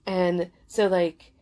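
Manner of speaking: ordinary talk, nothing marked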